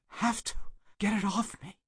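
high, strained desperate cry. Acted. Burgess answering Peters with the flare lit, desperate cry. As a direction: cry out, past all reason.

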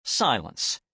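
ordinary delivery, nothing marked

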